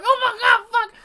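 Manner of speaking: very high, loud freak-out; hammed-up terrified exclamation; mock horrified panic. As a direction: relive the shock in a high-pitched outburst. Reliving shock at reaching hands.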